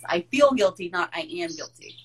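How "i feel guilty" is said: "feel" hit louder to set up a contrast; stress on feel. Shown as a demonstration. Host separating feeling guilty from being guilty, stress on "feel". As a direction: lean hard on the word "feel".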